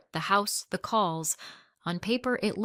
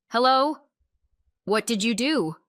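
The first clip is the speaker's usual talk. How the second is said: raised, indignant demand; narrated mother demanding answers, loud and higher; furious; 5 semitones higher, 7 dB louder than usual. A narrator's reading. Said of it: "It was furious".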